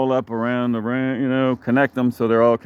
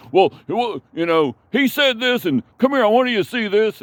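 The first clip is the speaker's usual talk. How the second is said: mimicking the pushy man; 11 semitones higher than usual. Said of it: "still trying to tug her".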